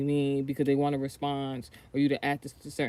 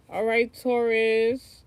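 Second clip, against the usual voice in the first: drawn-out greeting; 6 semitones higher, 6 dB louder, much slower than usual. Real, not acted. Greeting Taurus viewers in sign-off.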